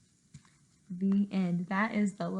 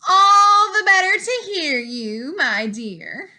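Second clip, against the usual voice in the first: high, loud, cheerful fake granny; the disguised wolf sweetly answering; 8 semitones higher, 7 dB louder, slower than usual. Wolf in disguise answering the girl, high cheerful voice. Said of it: "said the wolf in a cheerful voice".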